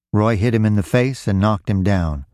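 usual speaking voice

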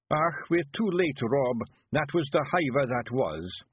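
dismayed little Scottish Feegle; gruff Scots, too late; Scottish accent. Acted.

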